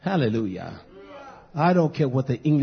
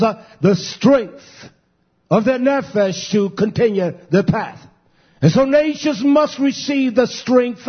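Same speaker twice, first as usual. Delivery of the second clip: full-force preaching; 8 semitones higher, 4 dB louder than usual. Acted. Preacher expounding Revelation to the flock, loud. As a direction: build the sermon up and drive it home.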